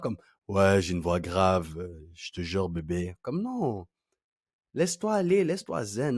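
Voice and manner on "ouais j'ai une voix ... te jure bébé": slow, forced low voice; mock a try-hard seducer, very low and slow; try-hard deep voice.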